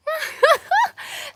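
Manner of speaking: very high, loud laugh; loud high cackle; real laugh. Real, not acted. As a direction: burst into delighted laughter. Amused at being called funny.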